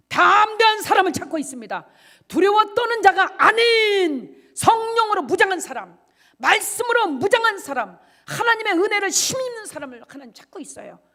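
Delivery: loud, high, impassioned sermon cry; full-force preaching. Acted. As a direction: preach it out with fire.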